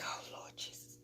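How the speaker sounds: unvoiced-breath whisper of a quick prayer; whispered dread. Onlooker praying as the fight brews, whispered dread. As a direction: whisper it like trouble is coming. Retold dread as everyone watches.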